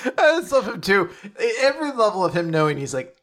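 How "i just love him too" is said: ordinary talk, nothing marked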